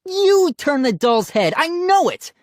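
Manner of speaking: high, loud accusation; screaming. A narrator's reading. Brother accusing sister of turning doll's head, screaming. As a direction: scream it with total certainty. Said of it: "Ian screamed at his sister".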